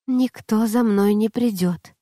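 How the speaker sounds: ordinary delivery, nothing marked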